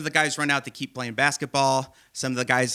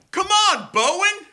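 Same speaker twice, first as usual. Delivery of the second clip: put-on much higher, louder teen heckle; mock bleacher yell; 5 dB louder, slower than usual. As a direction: yell from the stands at the last kid shooting. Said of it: "I hear a voice go".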